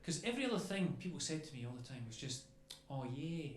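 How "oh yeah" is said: laid-back Australian, vowels stretched; chilled-out impression of an Australian; relaxed Australian accent. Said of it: "they sound so relaxed all the time".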